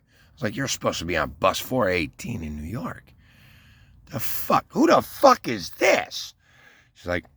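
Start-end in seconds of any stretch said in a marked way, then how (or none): 0.6-6.4 retold outburst. Man scolding his ex about the wrong bus, rising mock yell. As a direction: blow up at her, hammed-up.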